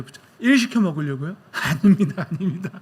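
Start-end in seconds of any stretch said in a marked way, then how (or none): 1.5-2.7 laughing it off, waving away the joke; laughing denial. Real, not acted. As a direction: chuckle through a playful no. Joking denial to congregation.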